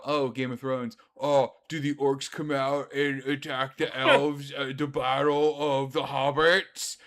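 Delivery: loud, dopey clueless voice; mock ignorant nerd voice; dumb bad voice. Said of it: "doing the bad voice for him".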